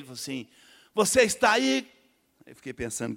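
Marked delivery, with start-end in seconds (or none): 0.9-1.9 high, loud call from the pulpit; preacher's call. Retold or imitated. Preacher calling the sinner forward, loud high call. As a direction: call out to the crowd. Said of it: "me chamou no final".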